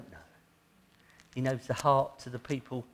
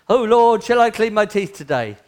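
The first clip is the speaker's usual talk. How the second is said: loud, much higher hammed-up plea; mock trivial prayer; 9 semitones higher, 15 dB louder than usual. Preacher mocking trivial prayers to the congregation, loud high mock plea. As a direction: pray in mock earnest about nothing. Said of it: "You know".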